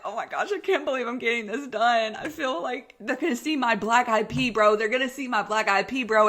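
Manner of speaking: high panicky inner voice, a bit fast; panicked self. Retold or imitated. Patient on the operating table panicking to herself, high and anxious. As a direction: fret like you're on the table. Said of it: "And I'm like".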